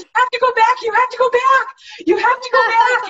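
high, loud urgent pleading; urgent fan, out of breath. Retold or imitated. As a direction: beg her to go back, frantic. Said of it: "She was out of breath".

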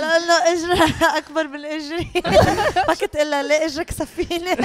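can't get it out for laughing; real laughing. Real, not acted. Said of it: "هاي بتضحككم؟".

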